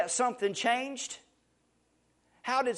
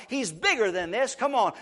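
ordinary talk

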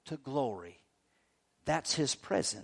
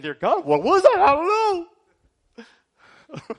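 hammed-up fright, higher and louder, fading to a whisper; mock panic; 12 semitones higher, 11 dB louder, slower than usual. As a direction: play the secretly terrified dad for laughs. Scared dad's inner thought.

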